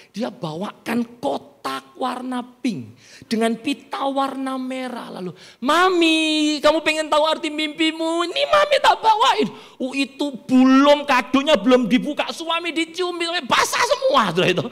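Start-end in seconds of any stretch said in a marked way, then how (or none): 5.6-9.5 high calling, climbing to a squeal; excited husband. Acted. Husband bringing Mami her gift box, high excited call. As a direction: proudly announce the surprise in a high voice.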